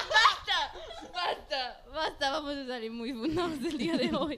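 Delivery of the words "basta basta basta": high, laughing cry to stop; laughing plea. Real, not acted. Co-host begging friends to stop the joke, laughing hard. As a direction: plead for a stop through laughter.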